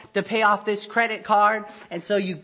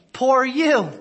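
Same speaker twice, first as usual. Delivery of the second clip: mock pity; 5 semitones higher, 5 dB louder than usual. Mocking self-pity James rejects.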